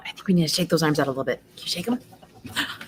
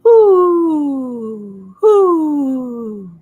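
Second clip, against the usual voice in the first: higher, louder hooting calls; owl hoot; 7 semitones higher, 7 dB louder than usual. Acted. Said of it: "Were crickets going".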